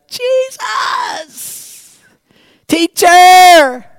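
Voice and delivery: very high, then loud shout of joy; overjoyed shout; ecstatic cry. Acted. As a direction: scream his name in joyful shock. Mary's sudden recognition.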